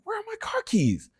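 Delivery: high, lost-keys question; puzzled search. Retold or imitated. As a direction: search the room, baffled. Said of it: "I'm like".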